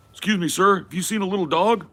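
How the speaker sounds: high, loud fussy pet owner; a hammed-up neighbor looking for a dog; mock worried pet owner. Acted pet owner asking.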